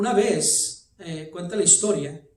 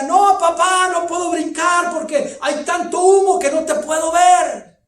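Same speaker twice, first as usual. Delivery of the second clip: put-on high, loud pleading cry of a trapped boy; frightened son; 10 semitones higher, 11 dB louder than usual. Son calls down to his father through the smoke, pleading. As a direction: beg from the smoke that you can't jump. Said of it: "Y el hijo decía".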